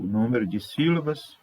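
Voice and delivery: ordinary delivery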